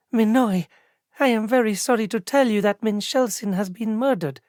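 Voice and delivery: lightly voiced clerk sorrowfully giving bad news; lightly voiced elven clerk. A narrator's reading. First clerk breaks the news.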